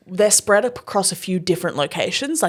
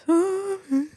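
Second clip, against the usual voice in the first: tiny high, soft voice; imitating timid singer; 12 semitones higher, 5 dB quieter than usual. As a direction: squeak it out softly, mimicking a nervous boy. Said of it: "he's literally going".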